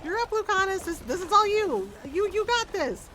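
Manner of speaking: high, loud mock cheerleading; playful egging on. Rook cheering Lucanus on from safety, playful high egging on. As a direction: push them forward with high-pitched pep. Rook pushes companion to fight.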